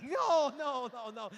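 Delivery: high, very loud protest; mock frustration. Woman rejecting the pizza answer, loud mock frustration. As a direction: wail the refusals in comic exasperation.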